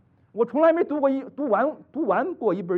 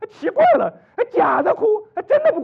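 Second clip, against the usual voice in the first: mock disbelief; 12 dB louder than usual. Real, not acted.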